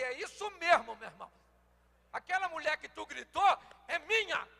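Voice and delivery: father scolding son loudly; angry father. Retold or imitated. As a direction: put the son in his place, loudly. Father confronting son who shouted.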